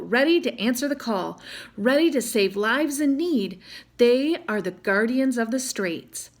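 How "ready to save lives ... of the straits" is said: ordinary delivery, nothing marked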